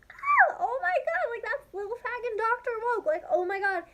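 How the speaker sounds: high squeaky fangirl gasp; starstruck squeal. Retold or imitated. Spotting her idols across the party, high starstruck squeal. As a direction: squeal like you just spotted your heroes.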